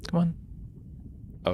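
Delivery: ordinary talk, nothing marked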